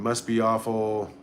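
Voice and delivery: ordinary delivery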